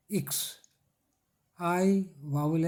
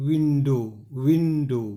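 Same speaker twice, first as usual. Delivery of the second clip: Window in two slow beats, WIN-DOW; syllable demonstration; 6 dB louder, much slower than usual. Shown as a demonstration. Teacher showing students the syllables of window, slow two-beat delivery. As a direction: break window into two puffs of air. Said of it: "जो झोंका हवा का".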